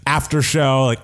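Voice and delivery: ordinary talk, nothing marked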